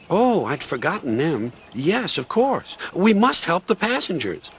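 lightly voiced cheerful diesel; Rusty the diesel. Rusty speaks.

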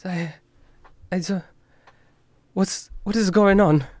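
lost and scared; scared confusion. Acted. Louis desperate.

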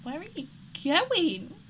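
high, loud, slow call after a wandering Sim; exasperated call. Real, not acted. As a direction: stretch the question out at her. Calling out to a Sim.

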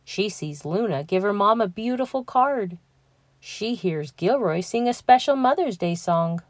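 ordinary delivery

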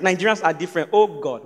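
ordinary delivery

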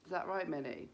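ordinary talk, nothing marked

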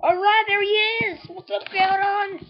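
high, drawn-out hello to a prize; sing-out it slowly, way up high; delighted high-pitched welcome; gleeful greeting. Real, not acted. Greeting the newly caught Pokemon.

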